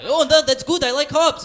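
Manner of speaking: very high, loud mock Russian voice; Russian accent. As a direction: squeal it in a silly Russian accent. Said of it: "this is a Russian terrorist that I'm gonna do".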